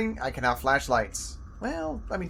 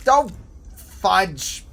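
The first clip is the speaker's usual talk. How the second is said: louder, exasperated; frustrated curse; 3 semitones higher, 8 dB louder than usual. Real, not acted. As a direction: swear in mild annoyance. Missed timing, frustration.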